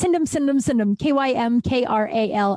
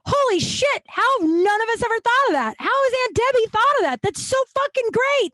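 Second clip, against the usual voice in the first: much higher, gushing group-chat excitement; excited gush; 13 semitones higher than usual. Retold or imitated. Reacting to her aunt's pun in the family chat, high excited gush. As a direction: gush like you just heard genius. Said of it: "And I was like".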